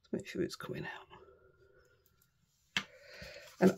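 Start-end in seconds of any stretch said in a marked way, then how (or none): 0.1-1.1 whispered under her breath while working; muttered to self. Real, not acted. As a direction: mutter it to yourself, barely voiced. Muttering to herself while crafting.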